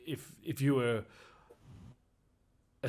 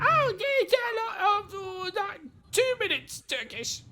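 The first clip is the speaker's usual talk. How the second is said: very high, squeaky goblin voice; nervous little goblin, much higher; 3 dB louder than usual. Acted. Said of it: "the goblin looks up and he goes".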